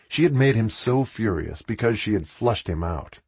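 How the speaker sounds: ordinary delivery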